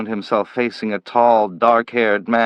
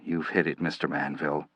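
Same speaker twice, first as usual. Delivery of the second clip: much softer, lower resigned agreement; meek admission; 6 semitones lower, 11 dB quieter, faster than usual. Acted. Slade admitting to Manville he is passive, meek and soft. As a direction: concede it quietly.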